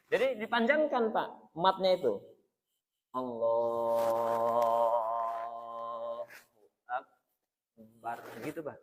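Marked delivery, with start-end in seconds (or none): 3.1-8.2 very slow, low, stretched Allahu Akbar; drawn-out takbir. Shown as a demonstration. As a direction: draw out the takbir long and low. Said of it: "Agak dipanjangkan". (takbir dipanjangkan pelan)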